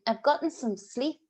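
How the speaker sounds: ordinary talk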